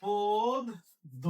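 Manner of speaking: পদ্য stretched out slowly; slow dictation. Shown as a demonstration. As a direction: drag out পদ্য for writing. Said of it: "লিখবো কি?".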